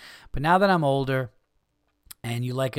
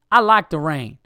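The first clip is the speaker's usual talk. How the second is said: mock Southern voice, punched out loud; Southern accent; 3 semitones higher, 11 dB louder than usual. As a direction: imitate a curt woman with a Southern accent. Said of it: "I just did a Southern accent".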